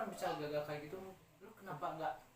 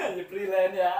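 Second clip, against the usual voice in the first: laughing; 3 semitones higher, 14 dB louder than usual. Real, not acted. Laughing at freelancer joke.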